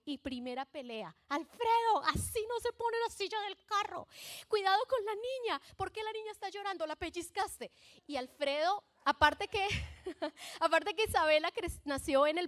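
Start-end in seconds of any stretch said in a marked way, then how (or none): 1.3-7.7 panicked new mom scolding her husband. Retold or imitated. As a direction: nag him about the baby seat. Wife scolding husband in retold fight.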